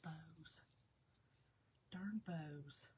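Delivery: soft, whispered complaint to herself; whispered grumble. Real, not acted. Crafter grumbling at the bow she is making, whispered. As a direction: breathe out the annoyance under your breath.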